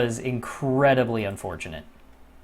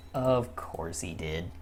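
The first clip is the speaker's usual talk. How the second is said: lower, softer, slow and dejected; quietly crushed, slow; slow defeated mutter; 7 semitones lower, 8 dB quieter, slower than usual. Real, not acted. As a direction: let it sink, slowly. Repeated, deflated.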